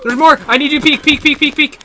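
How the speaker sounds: very high, loud urgent plea; panicked shouting. Real, not acted. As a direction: yell frantically for backup. Begs teammate for help under fire.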